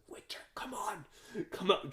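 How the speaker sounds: high, strained urging; acted-out pressing half-whisper rising to loud; urgent mate. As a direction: imitate a friend frantically calling you away. Mate urging him out of the kitchen.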